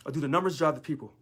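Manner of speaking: usual speaking voice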